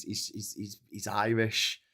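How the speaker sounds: ordinary talk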